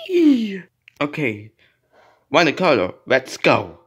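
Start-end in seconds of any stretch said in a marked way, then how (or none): 0.1-0.6 high pretend squeal of glee; gleeful whee. Toy riding off to school, gleeful squeal.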